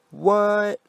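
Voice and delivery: dad pretending to be hurt; faked hurt. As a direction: play wounded surprise, overdone. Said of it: "I faked hurt feelings".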